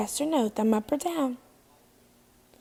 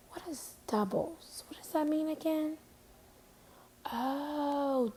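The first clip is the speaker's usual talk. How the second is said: very soft musing aloud; hushed mock puzzlement, then soft realization; pretend pondering; 4 semitones higher, 9 dB quieter than usual. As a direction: wonder quietly to yourself for the class's benefit. Teacher pretends to puzzle, then remember.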